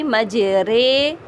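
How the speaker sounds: مجرے with ر said as رے, slow; imala demo. Shown as a demonstration. Teacher showing imala on مجرے, slow. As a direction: stretch مجرے, the ر leaning to رے. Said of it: "رے پڑھیں گے".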